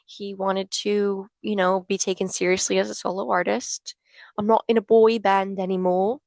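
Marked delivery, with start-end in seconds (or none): 4.4-6.2 put-on quick, louder stab at a British accent; bad British accent. Fan imitating Harry with a deliberately terrible British accent. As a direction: toss off a clumsy British accent, then laugh at yourself. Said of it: "That was a terrible accent".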